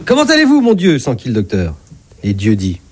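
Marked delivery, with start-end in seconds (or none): 0.1-1.0 high-pitched doctor's opening question; doctor greeting a patient. Acted. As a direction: pitch the greeting way up, then drop. Doctor greets his patient, God. (médecin qui accueille son patient, voix haut perchée)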